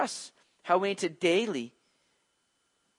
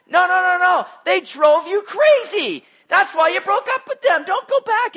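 high, loud, insistent no; fervent loud pleading; 11 semitones higher, 9 dB louder than usual. Real, not acted. Preacher begging the congregation not to go back, loud and urgent.

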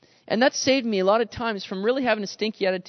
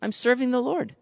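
cheerful praise; 6 semitones higher than usual. Retold or imitated. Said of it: "we can say".

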